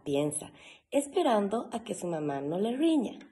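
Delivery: ordinary delivery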